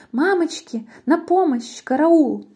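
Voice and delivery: high-pitched acted cry for help; panicked cry. Snake sees the Gruffalo and flees, panicked high cry. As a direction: yelp in mock terror.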